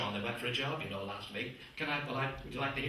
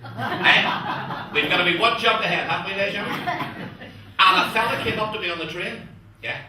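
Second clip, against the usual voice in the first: usual speaking voice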